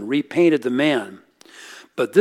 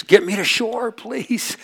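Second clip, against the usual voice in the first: desperate plea; 9 semitones higher, slower than usual. Retold or imitated. Drowning woman begs rescuer.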